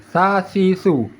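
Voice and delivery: "Sa, si, su" as TH of "thin"; tongue tip out, root level on "Sa, si, su"; flat-tongue tha. Shown as a demonstration. Teacher showing flat tongue, "Sa, si, su" lisped thin. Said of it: "dia datar".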